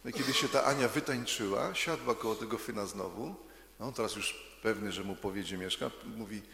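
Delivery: usual speaking voice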